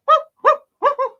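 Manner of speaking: high, loud yapping dog woofs; dog barking. Acted. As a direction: bark like an excited little dog. Dog Tom barks on being called.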